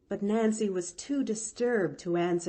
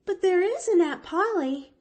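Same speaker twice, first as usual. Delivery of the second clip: put-on high, louder anxious child; anxious little girl, higher and louder; anxious demand; 12 semitones higher, 6 dB louder than usual. A narrator's reading. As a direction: press for it anxiously. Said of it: "demanded the child anxiously".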